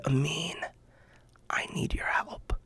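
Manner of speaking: soft, near-whispered plea for help; hushed plea. Retold or imitated. Friend on the phone asking Amin for help, hushed whisper. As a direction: ask quietly, almost whispering.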